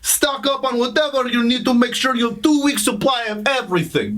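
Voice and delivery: mock Russian accent, comic asides; hammed-up Russian accent. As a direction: stay in the Russian spy voice. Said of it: "That accent is freaking crazy".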